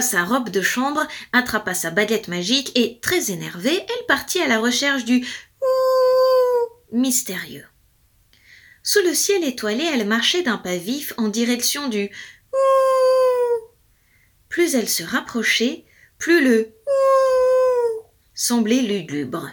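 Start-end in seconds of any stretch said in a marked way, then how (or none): 3.8-7.7 howl-tinged line. A narrator's reading. Howl in narration. 12.5-13.7 high, louder howling ouh; wolf howl. A narrator's reading. Storyteller howling as the fairy nears the wolf. 16.2-19.5 slow, high mournful howl; lugubrious howl. A narrator's reading. Storyteller howling as the sound grows gloomy.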